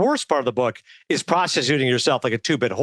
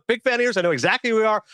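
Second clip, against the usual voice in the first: delighted quote; 7 semitones higher than usual. Retold or imitated. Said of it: "You go".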